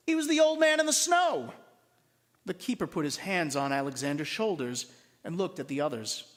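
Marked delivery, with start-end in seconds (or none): 0.1-1.5 surprised reveal. A narrator's reading. Narrator reveals the twist.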